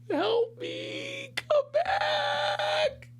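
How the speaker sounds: squeaky high falsetto cry for help; mock plea for help. Son pleading to his departing friend through the window, high squeaky cry. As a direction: cry out like a helpless little kid.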